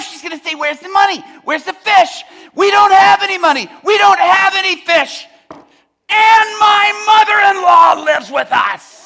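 high, exasperated shouted rant; despairing fisherman yelling at full volume; Peter hollering. Acted. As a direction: bellow your troubles to the whole crew. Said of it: "while he's hollering at his crew".

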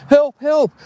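high mock cry of "Help, help."; imitating a scream for help. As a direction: call "Help, help." high and panicked, as the little sister. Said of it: "She's screaming".

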